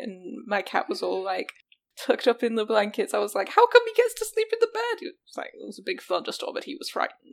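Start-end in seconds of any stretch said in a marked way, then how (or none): 3.5-5.0 put-on high, fast childish whine; jealous whine. Jealous whine about the cat.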